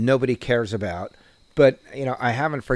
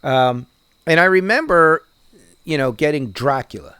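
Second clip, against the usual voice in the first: normal talking voice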